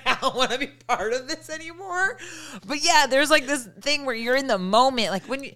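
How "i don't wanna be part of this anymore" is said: high, laughing mock dismay; through laughter. Friend joking about post-climax regret, laughing. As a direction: barely get it out for laughing.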